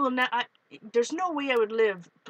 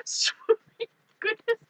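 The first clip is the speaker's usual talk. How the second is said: laughing; 11 semitones higher than usual. Real, not acted. Laughing after spider fright.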